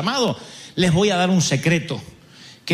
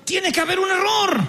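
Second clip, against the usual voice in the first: higher, alarmed denial; alarmed protest; 10 semitones higher, slower than usual. Retold or imitated. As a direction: cry out that it must be a mistake. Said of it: "Todos dicen". (protesta alarmada, aguda)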